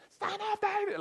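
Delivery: very high, loud desperate cry; the blind beggar shouting over the crowd at Jesus; desperate yell. Pretend. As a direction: scream it out desperately. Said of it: "the louder he gets".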